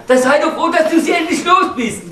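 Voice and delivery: hearty laughing cheer. Acted. Husband cheering his friend on the divorce, laughing shout. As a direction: slap him on the back with your voice.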